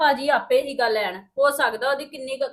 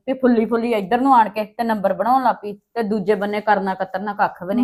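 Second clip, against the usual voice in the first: normal talking voice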